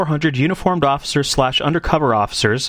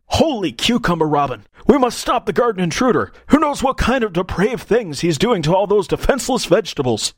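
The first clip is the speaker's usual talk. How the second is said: mock superhero; 4 semitones higher than usual. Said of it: "worst supervillain name of all time".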